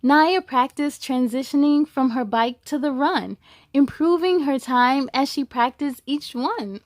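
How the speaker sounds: rhyming storybook read with a steady lilt; picture-book narration; reading to children. A narrator's reading. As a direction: read it to a class of kids. Reads a picture book aloud.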